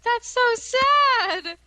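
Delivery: high, loud, drawn-out pity; exclaimed sympathy. Real, not acted. Listener reacting to an embarrassing vomit story, exclaimed sympathy.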